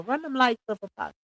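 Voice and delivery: usual speaking voice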